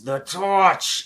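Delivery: the dragon barks out at the torch; sudden dragon cry. Acted.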